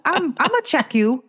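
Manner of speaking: high, sassy mock threat. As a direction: playfully threaten him, high. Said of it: "I'm like".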